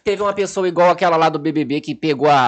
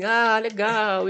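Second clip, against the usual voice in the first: mock polite clapping; 6 semitones higher, 4 dB quieter, much slower than usual. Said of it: "tipo assim".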